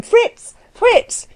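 very high-pitched hail; calling out. A narrator's reading. Anna summoning the coachman, calling out. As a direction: call a name across the yard. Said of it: "she began to call".

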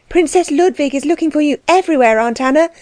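a hint of an earnest youngster; young niece Letty. A narrator's reading. Letty running up to her aunt, lightly voiced young niece.

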